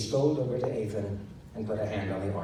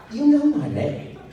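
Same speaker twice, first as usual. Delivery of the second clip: indignant protest; 9 dB louder than usual. Acted.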